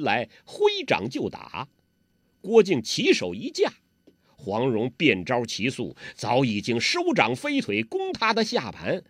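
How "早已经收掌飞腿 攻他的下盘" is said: plain reading voice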